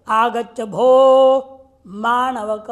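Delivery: slow, loud reading of the example; sutra recitation. Shown as a demonstration. Teacher to class, slow loud recitation of the example. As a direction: chant the example out slowly.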